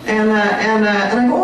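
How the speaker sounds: ordinary delivery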